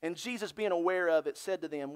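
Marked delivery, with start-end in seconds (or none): none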